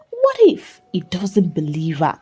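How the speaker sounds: plain reading voice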